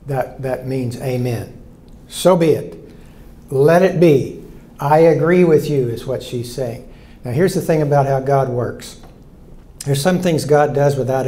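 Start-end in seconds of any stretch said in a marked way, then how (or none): 2.1-5.9 emphatic assent. Acted. Voicing Mary's assent.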